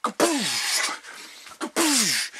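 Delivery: high mock shooting sounds; crossbow shot noises. Narrator firing the crossbow at the killer, high mock blasts. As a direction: fire off pretend shots. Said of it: "I'm like".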